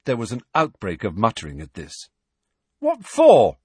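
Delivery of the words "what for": loud, high, indignant outburst; angry shout of protest. A narrator's reading. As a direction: burst out angrily in protest. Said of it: "said Harry angrily".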